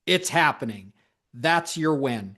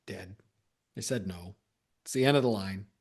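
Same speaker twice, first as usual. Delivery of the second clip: softer, dismissive shrug of a voice; dismissive giving up; 7 semitones lower, 5 dB quieter than usual. Retold or imitated. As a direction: let it drop quietly like it's over. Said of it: "I think often our mindset is like".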